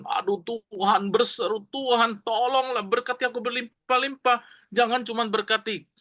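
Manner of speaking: high, pleading call to God; crying out to God. Acted. Sick Jabez cries out to God, high and pleading.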